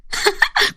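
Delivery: high, light teasing laugh. A narrator's reading. Friend reveals she was joking, high teasing laugh. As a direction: laugh at having fooled a friend.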